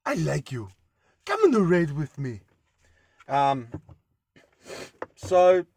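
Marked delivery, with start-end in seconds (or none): 0.1-2.4 light mock Viking accent; a hint of a TV Viking accent. Imitating TV show Vikings, lightly voiced Viking accent. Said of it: "they talk like this".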